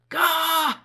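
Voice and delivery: loud high shout of exasperation; frustrated outburst. Acted. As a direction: blow up in frustration. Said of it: "It could be as simple as".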